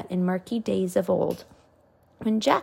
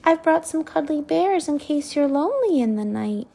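Sophie; 6 semitones higher than usual. A narrator's reading. Sophie offering bears.

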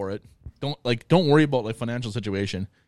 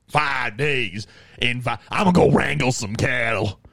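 mock Texan cowboy. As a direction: play a cattle-wrangling Texan, lightly voiced. Imitating Texas cowboy.

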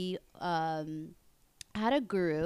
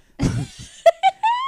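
high burst of laughter; loud laugh; 16 dB louder than usual. Real, not acted. Reacting to burping confession.

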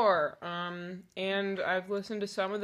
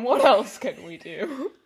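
laughing plea; 4 semitones higher, 10 dB louder than usual. Real, not acted. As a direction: laugh and whine it. Laughing reply to teasing mom.

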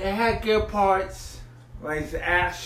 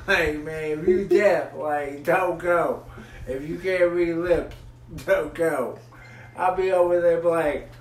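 ordinary talk, nothing marked

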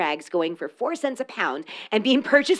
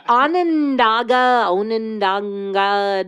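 slow drawn out; 4 dB louder, much slower than usual. Real, not acted. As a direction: stretch Onondaga out slowly. Drawn out place name.

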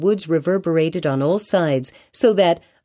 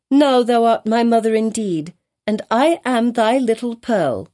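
put-on joyful child; 6 semitones higher than usual. A narrator's reading. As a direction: exclaim like a happy little girl. Child embraces mother.

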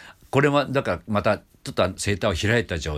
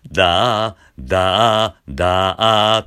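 loud da slid up from fry; scooped da; 8 dB louder, much slower than usual. Shown as a demonstration. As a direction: scoop each da up. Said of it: "これをしゃくって音程をつけて".

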